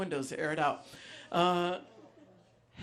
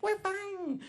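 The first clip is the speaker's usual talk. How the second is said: high, soft camp little reply; partygoer's camp little reply, mildly mocked; 5 dB quieter than usual. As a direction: squeak it out prissily. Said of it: "the gayest little voice".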